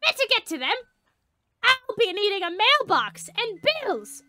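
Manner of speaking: high-pitched, grand declamation; hammed-up, squeaky self-important hero; theatrical little creature. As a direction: proclaim a new identity with pomp. Voices the bird character.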